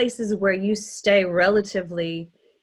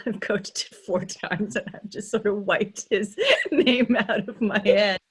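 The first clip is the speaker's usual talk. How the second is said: laughing; 3 semitones higher than usual. Real, not acted. Coach admitting a forgotten character name, laughing. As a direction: talk through helpless giggles.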